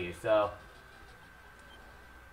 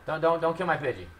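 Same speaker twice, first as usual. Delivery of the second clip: ordinary talk, nothing marked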